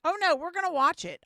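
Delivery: a real sudden loud jolt of agitation; loud excited outburst. Real, not acted. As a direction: burst out, suddenly fired up. Streamer decides to watch replay.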